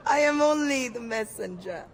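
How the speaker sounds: amused, half-laughing reply. Acted. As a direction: still laughing a little through the words. Laughing off the accusation.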